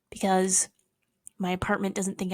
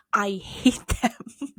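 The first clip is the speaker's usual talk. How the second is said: slow hate; much slower than usual. Pretend. Repeating hate slowly.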